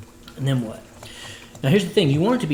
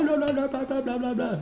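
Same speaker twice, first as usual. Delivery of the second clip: very high blah-blah chatter; silly high blabber; 12 semitones higher than usual. Retold or imitated. Driver babbles in a silly voice in his car, very high. Rambling in fun voices while driving.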